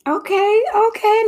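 high, rising okay okay; excited okay. Real, not acted. Host thrilled by the guest's point, high excited okays. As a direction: lift both okays way up, thrilled.

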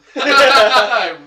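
loud burst of laughter; real laugh. Real, not acted. Friend cracking up after telling of tossing a roly-poly, loud laugh. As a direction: crack up in a big, high laugh.

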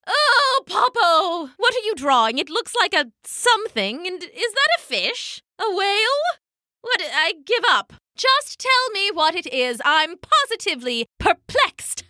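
high, rising, fed-up questions; lightly voiced impatient old hen; exasperated elder penguin. Elder female penguin pressing Popo about his sketch, exasperated.

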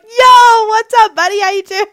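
loud, high-pitched pally greeting; hammed-up bro hello; fake chill. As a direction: overdo the friendliness to cover your tracks. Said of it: "so chill".

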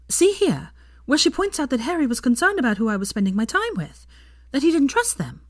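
a hint of Hermione pointing it out; lightly voiced Hermione.

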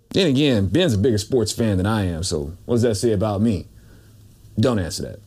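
low muttered self-aside. Acted. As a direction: drop low for a sheepish aside.

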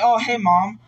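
high, loud, overly breezy hello; a bright put-on nonchalance; forced casual greeting. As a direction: act normal, too cheerful. Fake casual greeting to mom.

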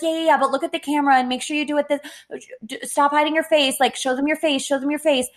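rushed, bossy stage-mom imitation; impatient vlogger mom. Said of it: "she was like".

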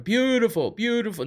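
high mock praise, lightly voiced; a quick sneering imitation; light mock gushing; mocking Conor. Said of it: "this is what he says over and over".

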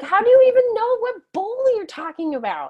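high, loud, mock outrage; exasperated disbelief. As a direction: act baffled at the child's fuss. Mocking toddler's bowl fuss.

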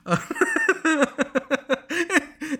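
squealing laugh at his own bit; real high laugh; high burst of laughter. Real, not acted. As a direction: crack up high.

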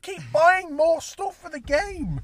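high, loud, slowed furious complaint; angry rant. Real, not acted. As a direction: rage at paying again. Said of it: "I'm very angry, can you tell?".

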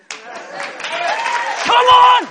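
high, full-force preacher's shout; explosive pulpit yell; rousing shout. Real, not acted. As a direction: fire up the congregation, shouting. Preacher rousing the crowd at climax.

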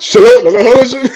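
high, loud mock command; mimicking the clip. Host echoing the viral video, loud high-pitched mimicry. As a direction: blurt it out loudly in imitation.

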